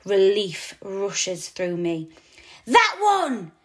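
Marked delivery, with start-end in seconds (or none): 2.7-3.5 high excited yell. A narrator's reading. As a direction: shout it out from the owl's back. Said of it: "Mousetrap yells".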